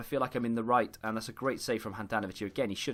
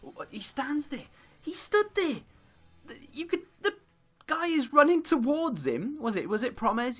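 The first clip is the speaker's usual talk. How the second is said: much higher, exasperated disbelief; squeaky outrage; 3 dB louder than usual. Real, not acted. Gamer watching his defender stand still, squeaking in outrage.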